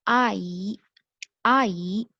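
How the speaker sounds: आई said firmly and clearly; Chinese word ayi. Shown as a demonstration. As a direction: model आई for the class, clear and steady. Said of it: "आई means aunty".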